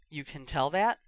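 usual speaking voice

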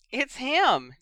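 high, loud excited shriek. Acted. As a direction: squeal with delight. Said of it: "Ginny shrieked".

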